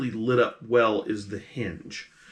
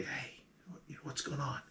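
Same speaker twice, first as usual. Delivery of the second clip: whisper; 3 semitones higher, 18 dB quieter than usual. Acted. GM voices player whispering to captive.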